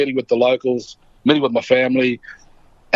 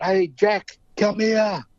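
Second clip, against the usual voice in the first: Marlon Brando; 7 semitones higher, much slower than usual. Retold or imitated. Brando calling his neighbour from the veranda, mild mock yell. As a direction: imitate calling out to a neighbour, held back. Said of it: "he yells out".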